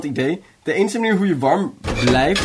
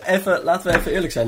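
laughing, pitched way up; 3 semitones higher than usual. Real, not acted. Agreeing with cohost they're still funny, laughing. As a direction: giggle through the words.

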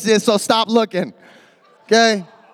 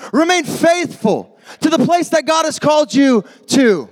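preaching at full force; 8 semitones higher than usual. Acted. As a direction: drive it home to the youth crowd. Preaching climax.

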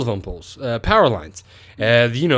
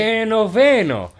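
"A Bruce Wayne" higher, louder and slow in a hammed-up Latin accent; mock Spanish accent that sounds Italian; 8 semitones higher, 4 dB louder, slower than usual. Shown as a demonstration. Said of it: "that was Italian".

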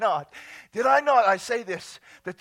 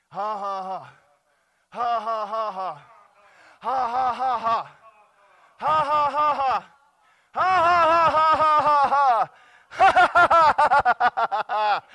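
measured ha-has building to loud, rapid peals; deliberate belly laughs; 11 dB louder than usual. Shown as a demonstration. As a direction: force the laughter out in growing bursts. Demonstrates laughing in church.

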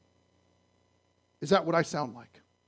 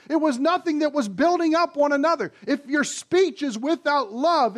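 fervent preaching; 6 semitones higher than usual. Acted. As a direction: drive the point home to the congregation. Preaching at full force.

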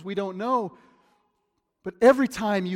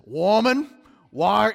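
louder, sharp demanding "Woman, why?"; harsh reading; 5 semitones higher, 10 dB louder, slower than usual. Shown as a demonstration. Preacher shows the harsh misreading of the angels. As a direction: snap "Woman" as a rebuke. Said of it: "I could read this as".

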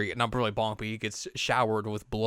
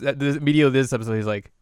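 ordinary talk, nothing marked

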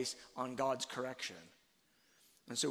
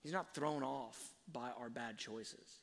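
ordinary talk

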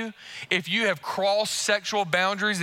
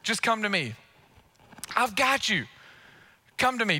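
tender voice of Jesus; 3 semitones higher than usual. Retold or imitated. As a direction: speak as a gentle Jesus calling you home. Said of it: "Jesus is saying".

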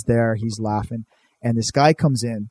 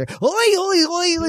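high, squeaky Oi oi chant; hammed-up sidekick chorus, very high; mock backing singer; slower than usual. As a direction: chirp the backup line like a goofy DJ. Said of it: "And the D J".

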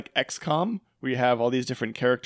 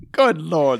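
a hint of a posh Englishman; lightly voiced British exclamation; playful fake posh British; mock British accent; 4 semitones higher, 6 dB louder than usual. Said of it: "they're so bad".

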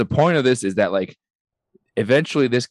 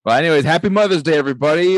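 sarcastic cheer; 6 semitones higher, 5 dB louder, faster than usual. Real, not acted. Sarcastic sign-off after grim story.

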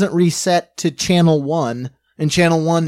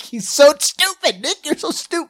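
laughing mockery; 9 semitones higher than usual. Real, not acted. Mocking Nick, laughing.

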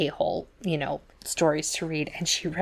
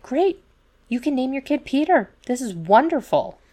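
much higher, hammed-up delight; mock gleeful in-laws; 8 semitones higher, 4 dB louder than usual. In-laws tell the couple they can now name him Peter, mock gleeful and high. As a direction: sing it out like greedy relatives cheering.

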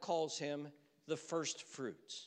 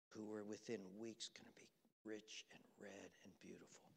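much softer, near whispered telling; hushed storytelling; 3 semitones lower, 9 dB quieter than usual. Acted. Pastor hushing the congregation into his strawberry story, near whispered. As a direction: draw them into the quiet field, barely above a whisper.

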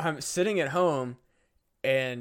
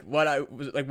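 normal talking voice

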